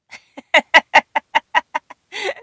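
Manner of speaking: a loud, high giggling fit; high burst of laughter. Real, not acted. Host laughing at a viewer's cheeky comment, giggling. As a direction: crack up at the viewer's joke.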